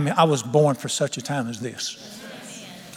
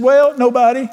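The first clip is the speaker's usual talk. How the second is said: high, louder imitation of a wife; wife's retort; 8 semitones higher, 6 dB louder than usual. Wife answering her angry husband, imitated high voice. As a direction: voice a scolded wife, pitched up.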